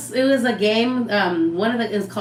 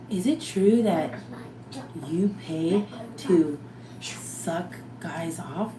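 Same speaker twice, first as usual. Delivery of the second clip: slow, halting question, trailing softer; drawn-out, careful asking with long gaps; hesitant asking; 6 dB quieter, much slower than usual. Retold or imitated. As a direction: pick through the awkward question word by word. Imitating his hesitant awkward question.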